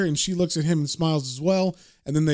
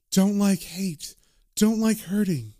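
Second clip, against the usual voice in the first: ghostly woman; 2 semitones higher, slower than usual. Retold or imitated. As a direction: drift slowly through short, plaintive ghost phrases. Ghost Laura pleads in broken phrases.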